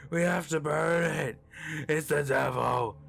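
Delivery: a crazed man insisting on burning it; frantic plea. Acted. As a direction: beg them, out of your mind. Panicked man pounding on trunk.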